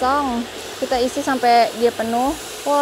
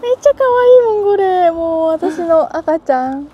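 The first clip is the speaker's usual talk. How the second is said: excited anime fan; 5 semitones higher, 9 dB louder than usual. Real, not acted. Gushing over the harvest.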